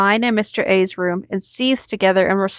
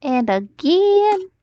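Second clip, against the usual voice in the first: mock drawl; 10 semitones higher, much slower than usual. Mocking the repeated text.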